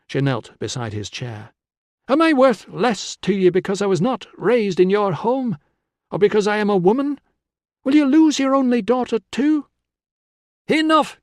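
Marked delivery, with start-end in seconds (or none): 2.1-9.7 high, loud, pleading questions; impassioned challenge. A narrator's reading. Daughter challenges her father. 10.7-11.2 loud, high-pitched roar. A narrator's reading. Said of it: "Con roared".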